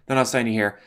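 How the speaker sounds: ordinary talk, nothing marked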